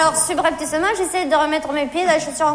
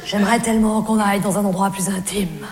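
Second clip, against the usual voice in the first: low-voiced amorous man; Jean-Loup; 7 semitones lower, 3 dB quieter than usual. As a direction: lower your voice to invite her somewhere intimate. Voicing Jean-Loup's invitation. (voix grave, invitation amoureuse)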